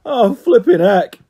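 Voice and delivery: loud slowed-down grumble of annoyance. Acted. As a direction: groan it out loud, fed up. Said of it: "it grumbled loudly".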